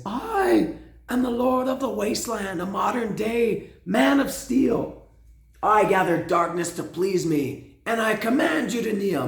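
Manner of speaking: high cracking theatrical voice. Retold or imitated. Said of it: "but his voice cracks and he says".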